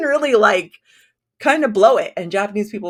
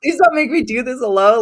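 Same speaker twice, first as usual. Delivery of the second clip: playful begging that builds higher and louder; pleading; 5 semitones higher, 5 dB louder than usual. Retold or imitated. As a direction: beg them, mock-desperate, rising to the end. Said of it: "emailing my list saying".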